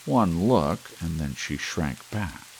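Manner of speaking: plain reading voice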